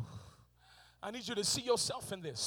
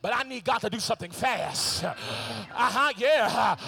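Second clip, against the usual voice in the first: loud, driving sermon climax; preacher urging the congregation to speak it aloud; preaching at full force; 2 semitones higher, 9 dB louder than usual. Acted. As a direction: push each line harder to the crowd.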